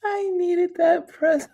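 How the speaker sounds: high, laughing admission. Real, not acted. Host echoing viewer's pretzel comment, high laughing. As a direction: squeal it out mid-laugh.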